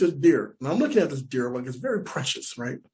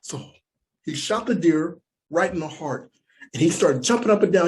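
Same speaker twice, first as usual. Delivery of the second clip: normal talking voice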